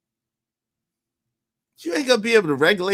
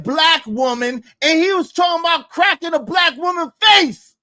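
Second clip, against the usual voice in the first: higher and louder, angry shout; heated outburst; 7 semitones higher, 4 dB louder than usual. Real, not acted. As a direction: shout it out in outrage. Heated accusation.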